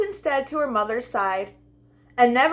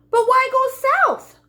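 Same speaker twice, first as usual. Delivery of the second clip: high, loud pleading cry; lamb cries out; 13 semitones higher, 5 dB louder than usual. A narrator's reading. Lamb cries to the departing butterfly, high and loud. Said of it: "cried the lamb".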